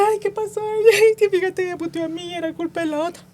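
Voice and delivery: high-pitched mock wailing complaint; imitated crying. Retelling a girl crying over unfair blame, mock-tearful. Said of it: "entonces la chava llorando".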